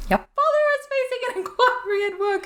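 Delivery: high, shrieking scolding mother; Molly Weasley howler. Pretend. Molly shrieking at Ron over the stolen car, high furious scolding. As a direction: screech it like a furious mum's letter. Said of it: "fun to say".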